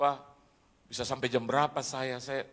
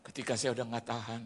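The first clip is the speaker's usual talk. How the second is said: ordinary talk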